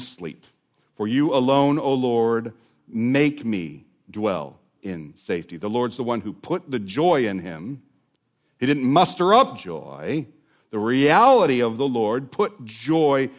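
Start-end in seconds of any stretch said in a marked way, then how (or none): none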